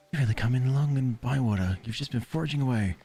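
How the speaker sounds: kindly game mentor. Acted. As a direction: hand over the reward kindly. Reads NPC gift dialogue.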